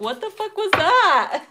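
high, loud startled disbelief; baffled outburst at the card; mock disbelief. Reacting to the card.

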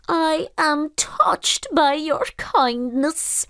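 high, slow, moved creature voice; touched almost to tears; pooka, tearful. Acted. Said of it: "said the pooka, with a tear".

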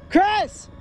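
high shout into the woods; calling from far away. Real, not acted. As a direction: yell a name across the trees. Searching woods for lost friend.

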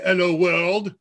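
loud mock French accent, dropping the H. Shown as a demonstration. Host mimics speech program reading English, French accent. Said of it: "make it apply a French accent".

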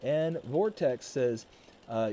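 ordinary delivery

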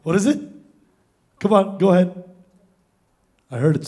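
ordinary talk, nothing marked

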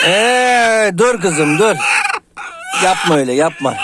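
loud sharp telling-off of the hen; scolding shout. Real, not acted. Owner shouting at a pecking hen, loud scolding. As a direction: shout her off, stop it now.

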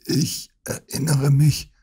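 mild slow groan; a touch of a moan. Acted. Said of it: "stöhnte Holmes".

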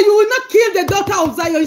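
ordinary delivery

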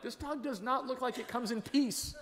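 high, wary aside to himself; wary inner voice. Retold or imitated. Preacher voicing his wary thought at a charging dog, high mock worry. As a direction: voice the nervous walker eyeing the dog. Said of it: "I'm like".

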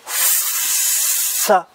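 "ça" said softly with a long hiss. Shown as a demonstration. Teacher sounding out S plus A for children. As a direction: draw out the S in "ça". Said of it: "ça va faire".

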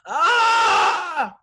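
high, loud yelp of pain; scream of agony. Acted. Boy in the dentist's chair screams in pain. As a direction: shriek as the tool jabs the tooth. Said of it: "Jason screamed in agony".

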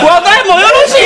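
high laughing granny voice; mock old-woman voice; old lady giving up. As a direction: play the resigned granny, laughing. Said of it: "言うて".

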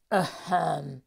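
low, loud ahem; deep throat clearing. A narrator's reading. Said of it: "räuspert sich eine tiefe Stimme".

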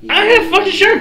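high, loud sarcastic okay; exasperated agreement. Acted. Xavier agreeing to buy the first round, high and loud. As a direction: give in with a loud sarcastic yes. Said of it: "first round on you?".

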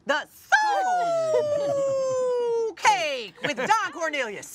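high, slow announcer call; Soul Train announcer. Acted. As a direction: stretch it like a TV announcer.

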